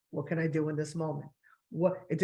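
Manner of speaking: normal talking voice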